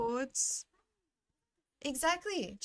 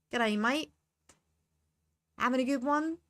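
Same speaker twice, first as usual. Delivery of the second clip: hammed-up Aussie bogan; awful Australian accent; 3 semitones higher, 5 dB louder than usual. Shown as a demonstration. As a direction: put on a bad Australian accent. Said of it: "my awful Australian accent".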